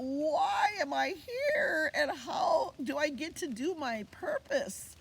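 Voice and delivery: high, slow crying wail; crying baby. Retold or imitated. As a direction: wail the question like a lost newborn. Newborn crying out question.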